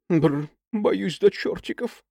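shuddering fear. Acted. Shuddering at ticks.

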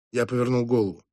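ordinary delivery

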